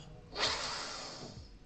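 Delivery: a long, breathy fed-up exhale; annoyed sigh. Retold or imitated. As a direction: sigh out your irritation. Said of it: "And I'm like".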